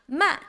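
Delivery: MA falling sharply from high; short falling tone on MA; fourth tone. Shown as a demonstration. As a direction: start "ma" high and drop it. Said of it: "start with a higher pitch and come down".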